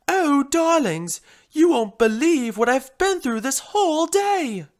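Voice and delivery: high, loud drama-queen lament; theatrical, exasperated gush; melodramatic. Acted. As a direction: wail it grandly to an audience. Drama queen tells her ordeal.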